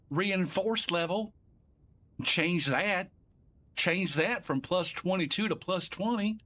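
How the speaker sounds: put-on a streamer narrating his cheat setup in a Southern accent. Cheerful Southern accent while clicking through menus. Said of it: "I don't know why I got Southern".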